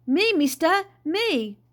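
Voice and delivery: high, loud, eager hail; calling out. A narrator's reading. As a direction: call up for attention from a distance. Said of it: "he stopped calling".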